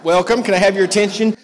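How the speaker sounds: high, loud call over the room; calling for attention. Real, not acted. Calling the youth group to order.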